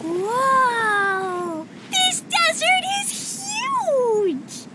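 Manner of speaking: long, drawn-out high exclamation; wide-open, high-pitched wonder; awestruck excitement. Acted. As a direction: cry out in amazement, stretching every word. First sight of the vast desert.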